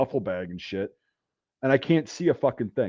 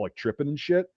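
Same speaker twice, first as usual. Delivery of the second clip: ordinary delivery, nothing marked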